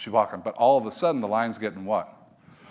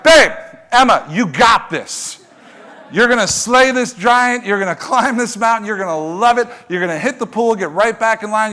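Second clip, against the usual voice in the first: loud, high motivational-speaker hype; Tony Robbins; 9 semitones higher, 9 dB louder than usual. Acted. Dad hyping scared daughter at the pool, motivational speaker. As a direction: pump her up, big and booming like a motivator. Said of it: "I turn into Tony Robbins, motivational speaker".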